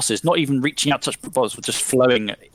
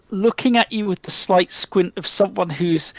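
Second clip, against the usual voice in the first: ordinary talk, nothing marked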